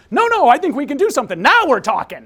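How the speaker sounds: loud, high, hammed-up eagerness; excited agreement. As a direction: jump in eagerly as the won-over agent. Agent suddenly won over by leverage.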